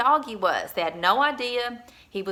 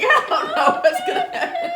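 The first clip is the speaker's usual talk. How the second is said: much higher, fast, laughing squeaky dog voice; voicing the dog Maxie; 8 dB louder, faster than usual. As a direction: give the old dog a panicky little squeak. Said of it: "Maxie's like".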